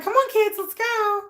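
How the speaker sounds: put-on high, singsong mom rallying her kids; peppy mom-to-kids voice; talking-to-kids voice. Shown as a demonstration. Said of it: "the way I talk to my kids".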